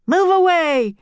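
high, loud order; yelling. A narrator's reading. Sailor ordering the children back, loud yell. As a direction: bellow a warning to clear off. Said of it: "yelled a sailor".

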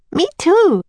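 put-on high-pitched eager little girl; Annie. A narrator's reading. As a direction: chime in high as the younger sister. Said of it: "said Annie".

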